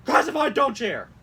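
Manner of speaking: high, loud urgent warning; mock all-caps shout. Host reads an email heading, shouting it. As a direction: yell it like an alarm. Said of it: "certain things that, like, is being all caps".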